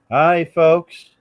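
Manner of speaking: hammed-up American accent, slow and loud at the start; mock American accent. Pretending to be Ryan Reynolds in the pubs, in a mock American accent. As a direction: put on a bad American accent, like an actor in a pub. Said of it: "I do my American accent".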